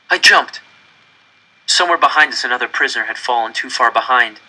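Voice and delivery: usual speaking voice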